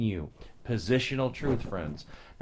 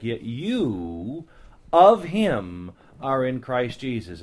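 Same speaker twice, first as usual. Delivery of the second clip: "Yet you of him are in Christ Jesus" read slowly and loud on "of him"; emphatic reading; 5 dB louder, slower than usual. Shown as a demonstration. The preacher reads the emphatic verse to his listeners, slowly and loud. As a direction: lean hard on of him, slowly. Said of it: "it's emphatic".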